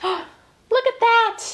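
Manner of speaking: slow, high, drawn-out gasp of wonder; amazed. Acted. To the kids, slow high wonder at the dinosaur bath. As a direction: stretch it out high and slow in awe.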